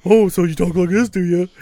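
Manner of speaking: put-on voice poking fun at someone; mocking imitation. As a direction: mimic your partner to make fun. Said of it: "You make fun of me".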